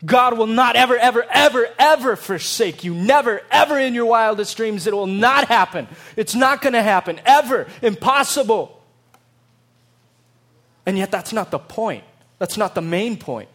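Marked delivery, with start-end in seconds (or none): 0.1-8.7 loud, building never ever; emphatic vow. Acted. Preacher voicing God's promise, loud and building. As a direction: pile up the nevers with rising force. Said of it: "he says".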